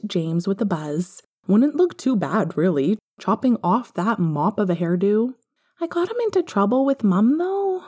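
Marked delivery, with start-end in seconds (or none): 5.8-7.8 put-on high, bright child asking a favour; young boy asking to watch TV; little boy voice. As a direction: pitch up into a small boy. Young Harry confesses a problem.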